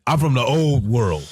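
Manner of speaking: lightly voiced gangster, weighty and grand; grand old-world gangster. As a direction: give it capital letters, a bit grand. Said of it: "Capital O, capital W".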